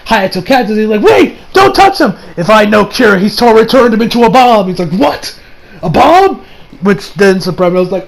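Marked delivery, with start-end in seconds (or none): 1.0-2.1 high-pitched retold cry to stop; urgent warning. Hayato catches him before he touches the bomb, urgent warning. 4.9-6.4 high-pitched acted astonishment; retold startled questions, pitched up; shocked disbelief. Josuke hearing that his friend is a bomb, shocked disbelief.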